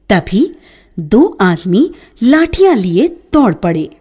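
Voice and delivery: ordinary delivery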